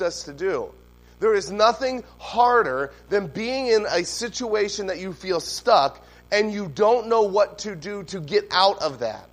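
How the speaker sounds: loud, fervent sermon delivery; preaching. Acted. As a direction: preach it out to the whole room. Preaching the sermon at full force.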